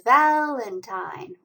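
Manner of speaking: "Valentine" enunciated, strongest on the first syllable; stress on VAL. Shown as a demonstration. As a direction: say "Valentine" clearly, punching val. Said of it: "It's the first one".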